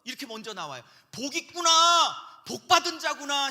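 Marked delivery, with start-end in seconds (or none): 1.1-3.5 higher, louder drawn-out proclamation; exclaimed blessing. Acted. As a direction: marvel aloud, stretching the words. Acts out Greek exclamation of blessing. (높고 길게 감탄하며 축복 선언)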